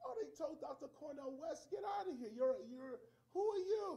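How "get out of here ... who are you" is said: high, loud brush-off; mock dismissive shout. Harvard telling Cornel West to leave, high and scornful. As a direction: shoo him out like an outsider.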